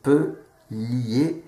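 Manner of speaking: very slow, syllable by syllable; lecture syllabe par syllabe. Shown as a demonstration. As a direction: sound out each syllable like a beginner reader. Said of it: "pour lire chapelier". (déchiffrer lentement chaque syllabe)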